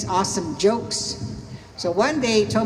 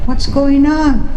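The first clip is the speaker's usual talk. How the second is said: slow, high, loud mock bewilderment; puzzled onlookers; 6 semitones higher, 10 dB louder, slower than usual. Two joke characters puzzled by the newcomer, slow high question. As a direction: draw out the question, pitched up and loud. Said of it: "both looking at him like".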